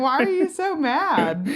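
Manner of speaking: high, slow, teasing question; mock taunting. Comic retelling her jab at the angry young guy, mock taunting. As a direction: draw it out to needle him.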